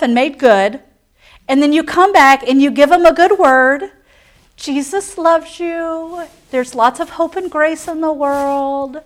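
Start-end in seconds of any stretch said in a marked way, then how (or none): 4.6-9.0 soft, slow, drawn-out reassurance; sugary mock preacher. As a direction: imitate a crowd-pleasing sermon, gently and slowly. Mock feel-good home sermon.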